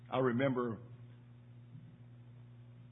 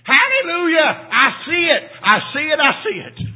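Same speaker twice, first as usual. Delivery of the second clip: much higher and much louder shouting of joy; ecstatic shout; 13 semitones higher, 13 dB louder than usual. Retold or imitated. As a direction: burst out like she finally gets it. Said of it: "she jumped up and said".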